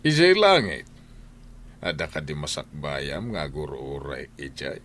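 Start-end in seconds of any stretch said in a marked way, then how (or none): 0.1-0.9 raised retort. Acted. Husband mocking wife's future.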